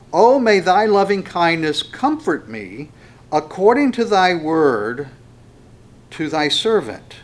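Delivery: loud, slow congregational reading; scripture reading. Acted. Preacher leading congregation in a psalm, loud scripture reading. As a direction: declaim it slowly for everyone to join in.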